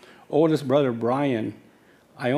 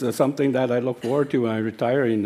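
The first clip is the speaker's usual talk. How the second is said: usual speaking voice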